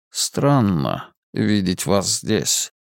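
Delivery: slow, low muffled voice; eldritch horror. Acted. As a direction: speak from far in darkness. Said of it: "Он звучал приглушённо, будто издалека".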